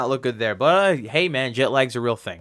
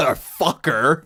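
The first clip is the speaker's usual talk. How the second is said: wrestler's taunt. Retold or imitated. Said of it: "middle fingers and going".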